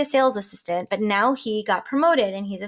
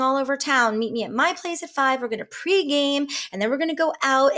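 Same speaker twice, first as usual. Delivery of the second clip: over-the-moon bride-to-be; excited friend; 6 semitones higher than usual. Acted. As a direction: gush like a newly engaged friend. Excited friend announcing her engagement.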